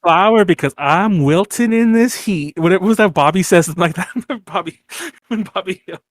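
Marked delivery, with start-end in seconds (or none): 0.0-2.6 louder, hammed-up Southern accent; Southern dandy. Host quotes a cartoon Southern dandy, theatrical Southern accent. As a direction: play a wilting Southern dandy. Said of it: "those kind of Southern accents".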